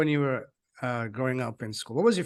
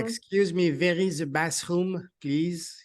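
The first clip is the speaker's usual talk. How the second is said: put-on slow, higher-pitched, very heavy French accent; thick French accent; 4 semitones higher, 3 dB louder, slower than usual. Host imitating his teenage self in Canada, thick French accent. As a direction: lay on a fat French accent. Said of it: "fattest French accent".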